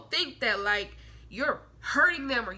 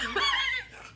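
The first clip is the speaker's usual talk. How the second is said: high laugh. Real, not acted. Laughs at own point.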